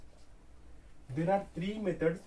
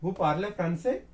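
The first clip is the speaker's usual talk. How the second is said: "français" lifted high and louder to ask; rising question tone; 3 semitones higher, 5 dB louder than usual. Shown as a demonstration. French teacher demonstrating the question form, tone raised at the end. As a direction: end "français" on a clear upswing. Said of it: "you are going to raise your tone, which makes the sense of question".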